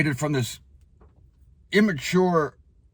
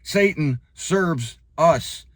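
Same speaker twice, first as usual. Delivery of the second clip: slow emphatic; much slower than usual. Acted. Repeats key claim slowly.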